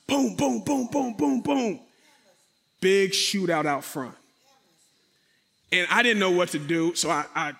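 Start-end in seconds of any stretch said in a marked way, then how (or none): 0.1-1.8 rapid high-pitched booms; imitated gunshots. Storyteller recreating the shootout out front, imitated gunshots. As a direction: fire off booms like a volley of shots. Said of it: "I heard all the gunshots".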